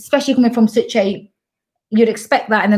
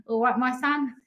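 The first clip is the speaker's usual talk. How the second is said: Coventry greeting, softer aside; mock Coventry accent; 2 semitones higher, 8 dB quieter than usual. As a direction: put on a Coventry accent, quietly. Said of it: "born and bred Coventry".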